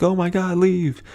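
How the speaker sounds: hammed-up alarm, shooing people off; freaking out. Garden people telling everyone to leave, mock panicked cry. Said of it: "everyone in the gardens are like".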